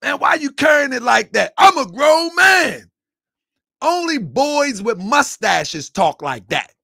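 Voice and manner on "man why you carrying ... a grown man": loud, high, huffy grown-man protest; mock indignant shout. Mocking men who claim to be grown, with a huffy shout. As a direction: ham up a boy acting tough. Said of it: "they always say that".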